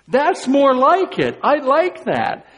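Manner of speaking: bright, pleased exclaim; delighted. Real, not acted. Preacher delighted by the word, pleased exclaim. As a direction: relish the better word.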